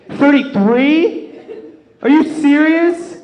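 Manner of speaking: high, slow, laughing disbelief; shocked disbelief. Real, not acted. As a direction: gasp it out like you can't believe it. Shocked at John's age.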